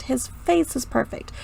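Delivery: ordinary talk, nothing marked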